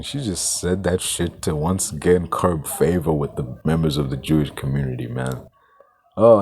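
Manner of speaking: normal talking voice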